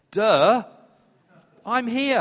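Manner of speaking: put-on high, loud teen-style duh; sassy as a teenager; mock duh. Imagined Jesus to parents, sassy duh. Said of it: "can you imagine Jesus going".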